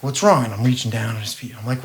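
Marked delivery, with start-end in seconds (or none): 0.0-0.4 higher, louder anxious ask; worried question. Retold or imitated. Son asking his father what's wrong, anxious. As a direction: press him, scared something's wrong.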